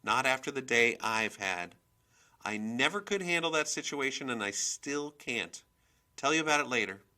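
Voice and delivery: high, loud, insistent chatter; worked-up mother on the phone; agitated phone talk. A narrator's reading. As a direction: vent loudly to your boyfriend. Agitated phone call to boyfriend.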